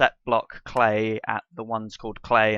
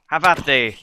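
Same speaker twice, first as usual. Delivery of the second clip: mock knight challenge; 3 semitones higher, 8 dB louder than usual.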